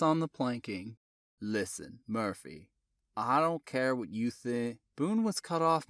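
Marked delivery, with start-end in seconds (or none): 1.4-4.8 Tidewater drawl. Acted. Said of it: "slow Tidewater drawl".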